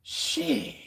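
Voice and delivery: high, drawn-out breathy sheesh; amazed exhale. Real, not acted. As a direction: let it out, spent and amazed. Exhausted amazement after the surge.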